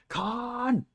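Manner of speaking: high, drawn-out movie scream of Khan; Khan yell. Pretend. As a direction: bellow the name like a furious villain. Echoing the famous Khan yell.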